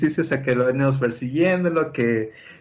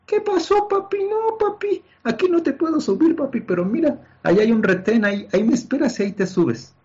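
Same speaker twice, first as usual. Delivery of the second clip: very high, squeaky put-on voice for the driver; mocking bus driver; 14 semitones higher than usual.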